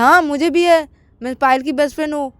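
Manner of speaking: match his claim smugly; retorting friend. Acted. Sakshi answering Aakash, proud retort.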